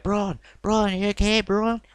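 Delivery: high-pitched imitation of a Southern American accent; a Southern drawl, pitched well up, calling out to a hurt friend. Backstage official checking on the fallen Braun, in a Southern accent. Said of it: "In his southern accent".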